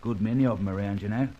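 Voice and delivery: knowing Cockney, matter-of-fact; London Cockney talking shop; Cockney accent. Acted. Old crook explaining villains to his friend, in Cockney.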